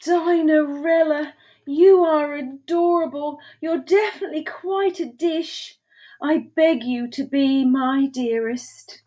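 high, tender wooing; adoring. A narrator's reading. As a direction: plead lovingly for her hand. Duke woos Dinerella in story.

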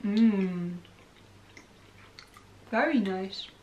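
eating toffee chocolate; talking while chewing. Real, not acted. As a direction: mouth full of chocolate. Seen 0: Unsure who speaks; left woman chews, hand at mouth throughout.

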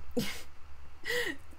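short high-pitched laugh. Real, not acted. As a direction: let out a brief, high giggle. Streamer laughs at herself.

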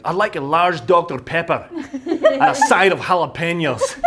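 laughing mock Scottish drive-thru order, high and loud; a cartoonish Scottish accent, cracking up. Coworker ordering at the drive-thru in a Scottish accent. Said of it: "with a Scottish accent".

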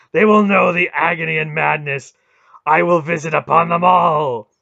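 wrathful god promising torment; snarling rage. A narrator's reading. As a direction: thunder out each threat in fury. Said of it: "Mara snarled".

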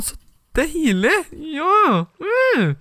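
very high, slow delighted squeal. Real, not acted.

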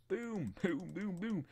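high-pitched booming gun noises; imitated gunshots. Player shooting Pico's enemy, high mock gunshots.

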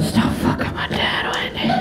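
voiceless seething whisper after screaming; furious whisper. Acted. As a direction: whisper it as a venomous order to the stepmom. Acted teenage outburst, suddenly unvoiced.

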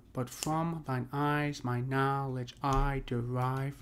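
slow, deliberate line of verse; measured verse reading. Shown as a demonstration. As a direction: draw the line out slowly so its sounds can be heard. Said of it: "Listen to the I sound too".

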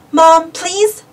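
high, drawn-out plea; lightly acted child's begging. A narrator's reading. Boy pleads with his mom to play the game, begging. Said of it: "begged Arthur".

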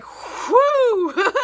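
high, loud exaggerated whew of relief; relieved whew. Dorian learning James is dead, mock relieved whew. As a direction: let out a hammed-up whew. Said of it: "why he was so relieved".

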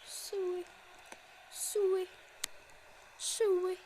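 high Ronaldo-style siu calls; Siu celebration. Retold or imitated. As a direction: call siu like a goal celebration. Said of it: "Ronaldo celebration cry".

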